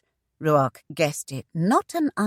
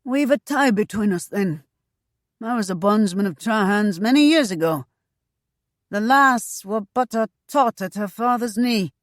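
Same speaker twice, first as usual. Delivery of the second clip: higher, louder, eager rough voice; fat eunuch tavern boss; 6 semitones higher, 5 dB louder than usual. Acted. Mother quizzes the newcomer, pitched up and eager. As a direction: lean in, pitched up and keen.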